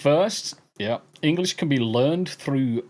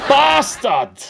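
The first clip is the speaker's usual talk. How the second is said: high, loud outburst of bastard; raised, hammed-up exclamation; mock shout; 9 semitones higher, 7 dB louder than usual. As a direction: yell it out as a jokey insult. Echoes a shouted mock insult.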